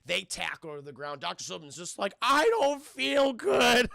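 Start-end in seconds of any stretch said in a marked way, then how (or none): 2.2-3.9 loud, high, slow complaint; hammed-up suffering shout; mock pained wail. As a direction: moan it loudly like an injured doctor. Acting the injured doctor.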